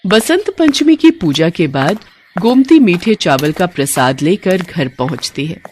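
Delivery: ordinary delivery, nothing marked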